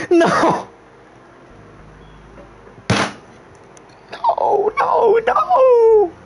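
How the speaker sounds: very high, drawn-out wails; mock-horrified wailing. Player finding terrible damage, mock-horrified wailing. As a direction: wail as if all is lost.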